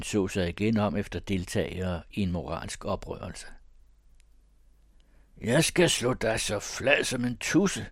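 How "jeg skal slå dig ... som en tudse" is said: raised, high-pitched bullying cry; shouted threat. Acted. Thomas crowding in on Paul, shouted threat. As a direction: bellow the threat in a pushed voice. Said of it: "råbte Thomas".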